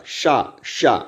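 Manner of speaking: शा with the tongue curled back; retroflex sha demo. Shown as a demonstration. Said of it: "वहाँ से दोस्तों इस वाले सा का उच्चारण होगा".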